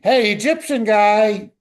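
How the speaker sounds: calling out loud into the desert; mock shout. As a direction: hail the stranger from afar, loud. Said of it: "shout out into the desert".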